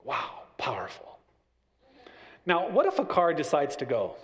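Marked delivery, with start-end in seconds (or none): none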